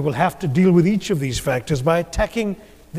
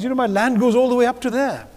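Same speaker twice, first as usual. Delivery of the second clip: higher, lightly acted proud farmer boasting; a hint of a proud Sikh farmer showing his land; 8 semitones higher than usual. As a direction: show off your little plot with mock pride. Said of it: "But he's very proud of it".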